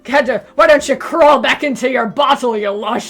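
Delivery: loud, slow, sneering tough-cop taunt; gruff cop voice. Pretend. Cop taunts washed-up detective Silver, loud gruff sneer. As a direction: growl it like a hard-boiled cop.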